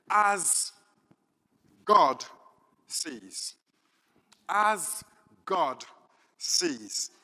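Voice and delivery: each word set apart, loud on As and God; preacher announcing his title, slow and weighty; sermon title announced. Acted.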